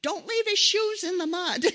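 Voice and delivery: high, loud shout from the shore; mother yelling. Retold or imitated. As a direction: call out urgently about the shoes. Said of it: "his mother the whole time is yelling".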